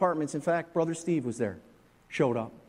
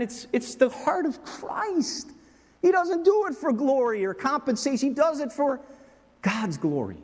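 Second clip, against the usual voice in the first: impassioned preaching; 10 semitones higher than usual. Acted. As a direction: lift your voice with conviction. Preaching about a friend's ministry.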